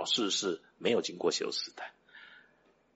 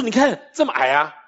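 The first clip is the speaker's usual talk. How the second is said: higher, mildly insistent retort; insistent father; 6 semitones higher, 4 dB louder than usual. Retold or imitated. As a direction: press the point in a retold argument. Father arguing with child, retold.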